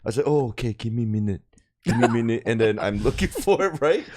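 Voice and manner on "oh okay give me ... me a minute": lower-pitched fake Korean accent; put-on Korean accent. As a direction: stall the cop in a faked Korean accent. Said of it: "Korean accent thing".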